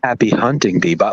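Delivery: low, slow, sultry radio DJ. Retold or imitated. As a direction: purr it like a late-night radio host. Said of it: "sultry radio voice ever".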